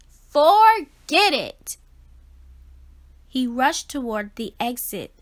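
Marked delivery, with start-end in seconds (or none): 0.1-1.8 loud, slow, higher cry of giving up; frustrated outburst. Acted. Fish giving up on school, loud despairing cry. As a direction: burst out, dragging the words.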